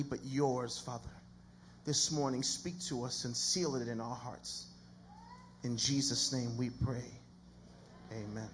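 slow, hushed prayer with long pauses. Real, not acted. Pastor praying before the sermon, slow and reverent.

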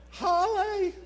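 high, loud comic cry; mock yelp. Sudden comic cry after subway story.

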